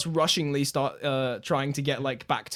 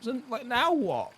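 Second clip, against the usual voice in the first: higher, baffled voice; puzzled boatman; 9 semitones higher, 4 dB quieter than usual. Acted. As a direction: ask it high and lost. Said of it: "He's like".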